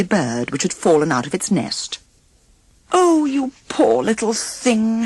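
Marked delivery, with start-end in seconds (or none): 2.9-5.0 said tenderly. A narrator's reading. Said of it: "she said tenderly".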